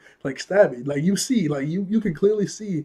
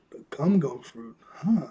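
lightly imitated puzzlement, ending in a questioning huh; confused echo; 4 dB quieter, slower than usual. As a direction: repeat it back, mildly baffled. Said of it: "He's, like, really confused".

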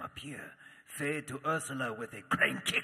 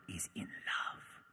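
lower, slow whispered aside; gossipy whisper; 6 semitones lower, 8 dB quieter, slower than usual. Acted. Narrator voicing sisters telling the king, slow hushed whisper. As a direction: whisper it like a secret.

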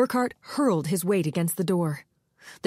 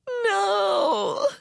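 much higher, crying wail; crying moan; 14 semitones higher than usual. Acted. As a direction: wail through tears. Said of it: "she moaned".

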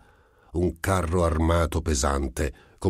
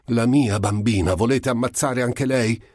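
louder and higher mother's cry; desperate shout; 7 semitones higher, 4 dB louder than usual. Acted. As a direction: yell at the agents to protect her child. Mother shouting at armed agents.

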